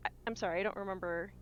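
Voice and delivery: ordinary delivery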